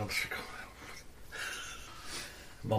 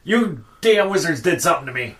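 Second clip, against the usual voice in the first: loud, raised-pitch blame; acted angry accusation; 6 semitones higher, 9 dB louder than usual. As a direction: lash out at the culprit. Distressed character accuses the wizard.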